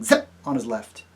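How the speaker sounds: ordinary delivery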